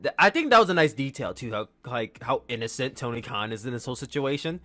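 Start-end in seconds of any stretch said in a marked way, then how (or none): none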